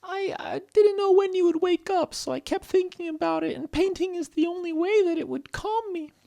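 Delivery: very high, squeaky confession; high-pitched, emotional little rabbit; confiding Stella. Acted. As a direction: explain haltingly in a tiny high character voice. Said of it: "And Stella said".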